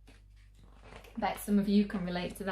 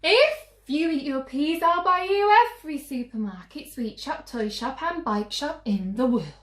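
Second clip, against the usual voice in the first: much higher, louder and fast, piling it on; desperate mum; 9 semitones higher, 7 dB louder, faster than usual. Acted. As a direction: promise the whole world in one breath. Mum's desperate endless bribe.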